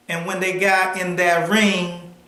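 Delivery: ordinary talk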